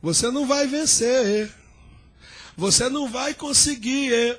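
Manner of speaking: ordinary delivery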